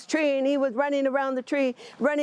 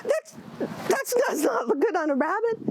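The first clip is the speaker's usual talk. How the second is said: much higher, picky little rabbit voice; fussy rabbit voice; 12 semitones higher than usual. Acted. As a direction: turn up your nose at the tail. Rabbit refusing turtle's tail.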